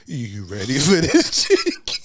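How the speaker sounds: mock sultry host, slow. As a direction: purr it like a late-night host. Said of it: "in the deep sultry voice say".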